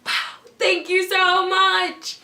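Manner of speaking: high, loud, drawn-out gush; gushing thanks. Retold or imitated. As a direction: act out being hugely flattered. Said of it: "I was just like".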